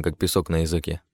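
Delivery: ordinary delivery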